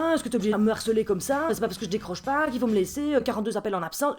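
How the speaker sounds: annoyed husband. Retold or imitated. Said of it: "qui me rappelle et qui me fait".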